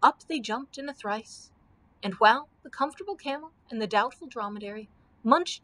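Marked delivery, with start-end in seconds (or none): none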